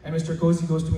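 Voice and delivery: normal talking voice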